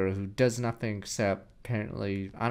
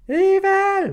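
loud, high shriek of evil; building to a screaming accusation; mock outraged yell; 8 semitones higher, 11 dB louder than usual. Real, not acted. Yelling at the nurse.

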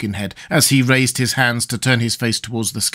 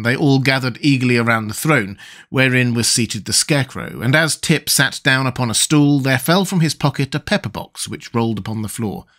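usual speaking voice

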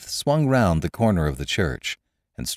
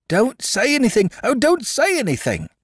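much higher, louder excited plea; frantic cry; 4 dB louder than usual. A narrator's reading. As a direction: cry out in agitated glee. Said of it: "cried the atheist cobbler".

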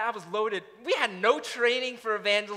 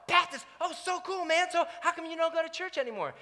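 eager questions; 9 semitones higher than usual. Retold or imitated. Recounts eager follow-up questions.

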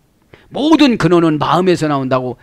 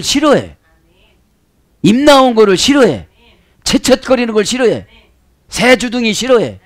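raised, emphatic list of dislikes; voiced complaint; 5 semitones higher, 3 dB louder than usual. Preacher speaks as the Lord listing what he dislikes, insistent complaint. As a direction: voice a higher-pitched, insistent complaint.

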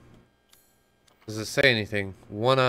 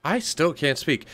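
ordinary delivery

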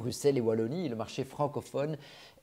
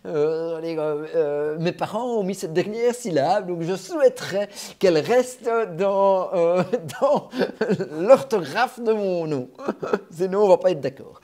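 loud, raised voice breaking into laughter; jokingly insist, laughing between phrases; high, loud, laughing complaint; amused mock protest; 5 semitones higher, 8 dB louder than usual. Real, not acted. Jokingly defends his name's spelling.

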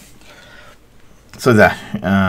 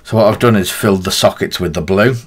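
ordinary delivery

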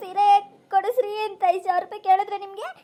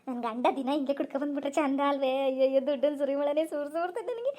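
softer, lower gloating aside; gleeful aside; 3 semitones lower, 6 dB quieter than usual. Acted. Wife gloating alone over the stolen money, softer. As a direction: gloat quietly to yourself.

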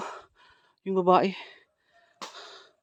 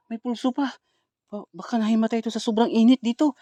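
ordinary talk, nothing marked

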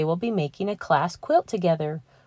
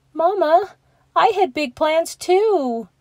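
put-on pleading child; 11 semitones higher, 4 dB louder, slower than usual. Child pleads to his mother.

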